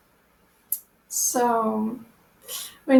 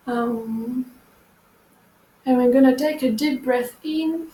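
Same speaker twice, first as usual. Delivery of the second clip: guided meditation. Acted. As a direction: ease them into breathing gently. Leads a meditation.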